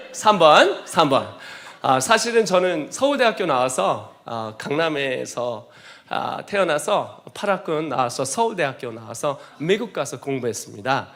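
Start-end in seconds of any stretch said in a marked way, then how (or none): none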